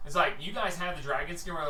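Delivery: ordinary talk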